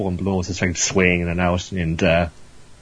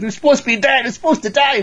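very high, louder mock shriek; outraged poisoner; 4 dB louder than usual. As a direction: protest in thwarted, indignant disbelief. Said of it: "complete insult to her pride".

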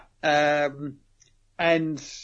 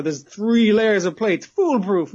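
louder, higher, slow villainous bragging; hammed-up boasting; 5 semitones higher, 4 dB louder, slower than usual. Host boasting to Tanigawa, hammed-up gloating. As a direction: savour the boast, theatrically.

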